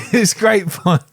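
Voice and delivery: hammed-up British critic; David Tennant. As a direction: gush in a put-on British voice. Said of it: "She talks like a very angry David Tennant".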